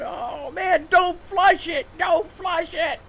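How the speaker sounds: very high, very slow mock panic; panicked drunk. Drunk floundering in the pool, very high panicky wail.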